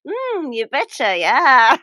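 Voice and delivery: slow, singsong Minnesotan, swooping pitch; hammed-up folksy Minnesota accent. Podcaster imitating the police chief, slow hammed-up Minnesota accent. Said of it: "is it the Minnesota accent?".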